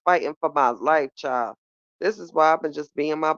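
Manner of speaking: ordinary talk, nothing marked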